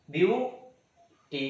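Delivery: Beauty slowly, view, long pause, टी; syllable-by-syllable demo. Shown as a demonstration. Teacher gives another two-part word, syllable by syllable. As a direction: break beauty into two separate beats. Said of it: "दो बार में बोलाता है".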